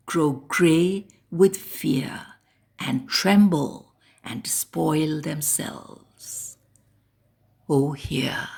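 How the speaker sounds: slow, fading near-whisper; hushed dread. Acted. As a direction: let the verse sink into an awed hush. Poem's fearful hush into refrain.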